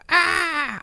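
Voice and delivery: very high, loud squeaky cry; Toad voice. Retold or imitated. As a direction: yelp like a tiny mushroom hero. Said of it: "He's just like".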